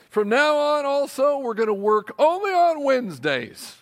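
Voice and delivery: milk each new perk; mock excited announcement. Rep piling on perks, excited and loud.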